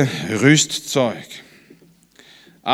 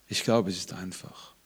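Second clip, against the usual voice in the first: soft echo; 10 semitones lower, 15 dB quieter than usual. Shown as a demonstration. Same line echoed much softer.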